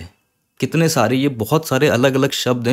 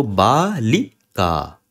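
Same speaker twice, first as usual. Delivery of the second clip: careful slow word; much slower than usual. Shown as a demonstration. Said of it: "ये सही से उच्चारण कर सकते हैं".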